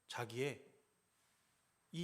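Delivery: ordinary talk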